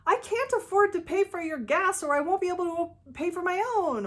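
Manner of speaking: emotional defending. Retold or imitated. Partner pushed for gas money, answering with an emotional plea. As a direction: defend yourself in a rising, upset plea. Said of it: "respond emotionally with things like".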